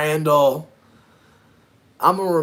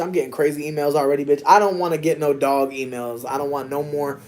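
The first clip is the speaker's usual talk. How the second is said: usual speaking voice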